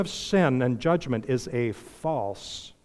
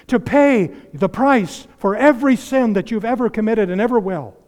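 fervent preaching; 7 semitones higher, 7 dB louder than usual. Real, not acted. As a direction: preach the cross with full fervour. Preaching climax on the cross.

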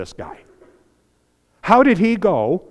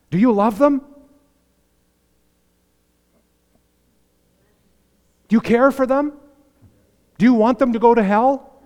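higher and louder pleading questions; raised, pressing questions about their loved ones; urgent appeal; 7 semitones higher, 5 dB louder than usual. Acted. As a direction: press each question on them.

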